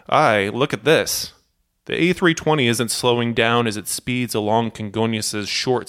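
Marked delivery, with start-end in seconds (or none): none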